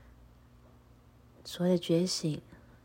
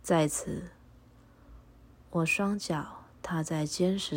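prayer recitation. Acted. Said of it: "请和我一起复诵一下祈祷文".

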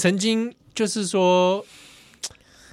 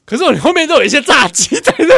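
raised retort; 10 semitones higher, 8 dB louder than usual. Retold or imitated. Retold retort, loud.

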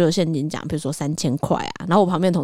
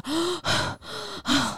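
gasp; 12 semitones higher, 7 dB quieter than usual. Retold or imitated. Reacting to losing the raffle.

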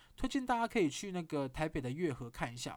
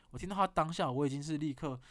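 ordinary talk, nothing marked

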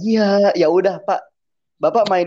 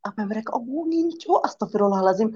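baffled; 6 semitones higher than usual. Retold or imitated. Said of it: "gue tuh kayak".

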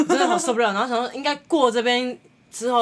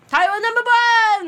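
much higher, louder, slow; mock hype; 13 semitones higher, 7 dB louder, slower than usual. Boat DJ hyping the aunties, loud mock chant. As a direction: hype it up like a party DJ.

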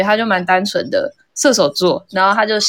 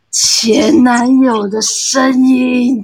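spooky slow reveal; 4 semitones higher, 4 dB louder, much slower than usual. Retold or imitated.

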